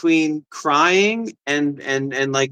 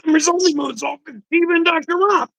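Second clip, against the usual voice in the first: much higher taunting mock voice; mocking viewer; 11 semitones higher than usual. Streamer mocking Arcadian's comment in a high taunting voice. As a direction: sing it out like a smug viewer.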